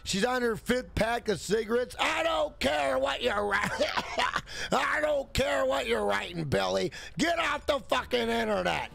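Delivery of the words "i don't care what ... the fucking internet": shouted angry mother; mom shouting. Retold or imitated. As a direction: scream at the kid to log off. Mom yelling at son.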